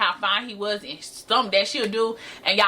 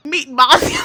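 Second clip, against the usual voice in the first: loud, high burst through laughter; real helpless laughing outburst; cracking up; 7 semitones higher, 9 dB louder than usual. Real, not acted. Cracking up at own insult.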